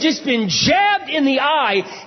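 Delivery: high, slow, stretched-out exclamation; impassioned preaching. Real, not acted. Preacher telling the unmarried how hard silence is, impassioned. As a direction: cry out each phrase with heavy emphasis. Preaches at full force about marriage.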